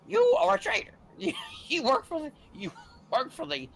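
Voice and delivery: high, whiny Darth Vader. Retold or imitated. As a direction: whine it out like a bad actor. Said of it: "It was like this real whiny voice".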